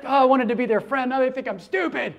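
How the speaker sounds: a light, hammed-up cringe at her own words; mock embarrassed groan. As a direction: play the fan kicking herself after a blunder. Embarrassed fan's inner voice.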